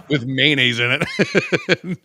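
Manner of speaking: laughing through the words. Real, not acted. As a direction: giggle while you say it. Laughing while telling horse story.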